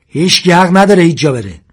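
high, loud forbidding yell; angry shout. A narrator's reading. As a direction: shout a ban at everyone. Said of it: "ساسان داد زد". (فریاد خشمگین و آمرانه)

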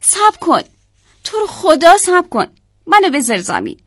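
high, pleading yell; loud cry. A narrator's reading. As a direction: call out begging to be put down. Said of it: "یه داد بلند زد و گفت".

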